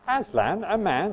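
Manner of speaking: a hint of a stern Beaver; lightly voiced, stern and incredulous. A narrator's reading. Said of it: "said the Beaver sternly".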